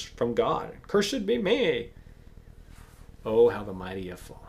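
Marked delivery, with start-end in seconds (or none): 0.9-1.9 high, slow self-curse; anguished oath. Acted. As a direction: drag it out in despair. Peter curses himself.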